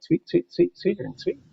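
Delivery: high, rhythmic chirping phrase; sing-song bird imitation; birdsong mnemonic. Shown as a demonstration. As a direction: mimic the warbler's song with words, pitched well up. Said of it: "if you can hear that".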